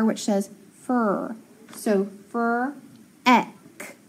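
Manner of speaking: "fur" then "ek", slow and separate; sounding out. Shown as a demonstration. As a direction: split into "fur" and "ek" like a phonics teacher. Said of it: "So I have that F R".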